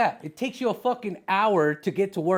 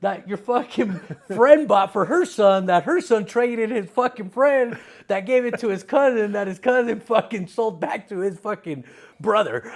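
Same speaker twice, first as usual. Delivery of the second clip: loud, higher, heated complaint; mock indignant rant; 6 semitones higher, 8 dB louder than usual. Kid complaining to mom about his bike, loud and indignant. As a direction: vent about the hand-me-down Huffy.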